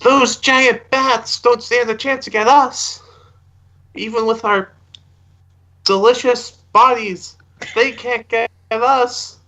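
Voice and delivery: high, drawn-out taunt into a cave; slow, high-pitched mock bravado; taunting call. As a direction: goad the unseen bats in a singsong holler. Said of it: "I'll, I'll say".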